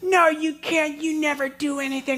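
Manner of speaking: put-on high, whiny child snapping back; angry little girl. Daughter snapping at dad over the bike, high and whiny. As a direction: throw it back like a furious four-year-old.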